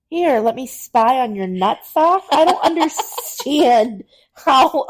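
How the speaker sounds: high, loud, slow disbelief; incredulous laughing. Real, not acted. Mocking the spy definition.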